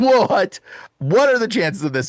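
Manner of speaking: freaked out. Retold or imitated. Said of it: "I'm officially freaked out".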